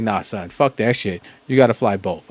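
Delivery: ordinary talk, nothing marked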